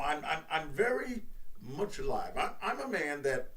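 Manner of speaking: usual speaking voice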